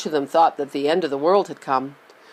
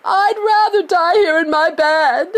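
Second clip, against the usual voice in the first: high, loud, slow tearful wail; stubborn cry of refusal; old woman refusing to leave her bed; shout; 10 semitones higher, 6 dB louder, slower than usual. A narrator's reading. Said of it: "shouted Grandma Josephine".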